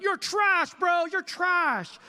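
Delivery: high, loud mocking shout of a kid on Xbox; trash-talking kid gamer. A father imitating his sons on Xbox, mocking high shout. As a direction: yell it like a boy insulting his brother mid-game. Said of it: "It's like".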